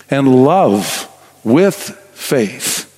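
very slow, love and faith each set apart; stress on love and faith. Real, not acted. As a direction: lean hard on love, pause, then land faith. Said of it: "those words I emphasized verbally just now".

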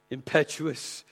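ordinary delivery

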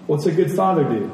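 ordinary talk, nothing marked

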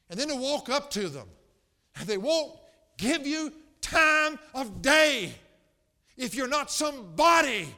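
loud, high, impassioned preacher; full-force preaching. Real, not acted. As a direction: preach it hard and high, building indignation.

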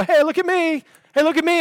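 high, loud show-off; hammed-up "look at me" boasting; mock attention-seeker. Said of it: "doesn't show up on the scene and go".

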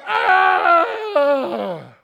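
high loud mock shriek of disgust; kids' shriek. Toddlers biting broccoli lollipops, high mock yelp. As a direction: imitate toddlers yelping at broccoli. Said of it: "And they're like".

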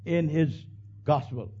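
ordinary talk, nothing marked

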